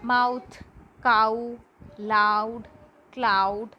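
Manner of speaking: "Mouth, cow, loud, cloud" with a full ow glide; each word said singly, strong "ow"; ow diphthong. Shown as a demonstration. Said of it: "Then ow sound".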